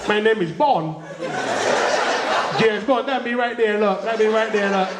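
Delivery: high, laughing Bajan Bond. Retold or imitated. Comic's Bajan Bond introducing himself, laughing Bajan accent. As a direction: brag it in broad Bajan, giggling. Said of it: "give a little Bajan twist".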